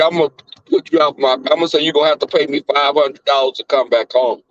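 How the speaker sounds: usual speaking voice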